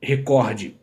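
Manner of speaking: "recorde" with the stress on COR; stress on the middle syllable. Shown as a demonstration. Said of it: "É o caso da palavra".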